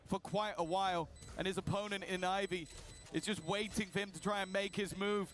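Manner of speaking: rising excited play-by-play call; esports caster. Real, not acted. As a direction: build to a shouted clutch call. Live play-by-play clutch call.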